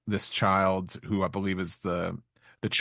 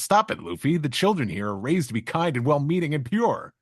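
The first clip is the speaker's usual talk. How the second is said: mock berating, higher and louder; 7 semitones higher, 3 dB louder than usual. Sanji berating Luffy, mock scolding higher. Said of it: "berates him here and says".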